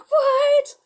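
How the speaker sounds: loud, high gasp into a stunned cry; hammed-up, shrieky disbelief; mock astonishment. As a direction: imitate a crowd dropping everything in shock. Voicing onlookers' shocked reaction.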